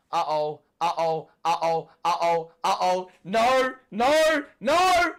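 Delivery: anxious uh-ohs rising to loud shouted noes; building panic. Real, not acted. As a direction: panic rising with every repeat. Fan watching danger build.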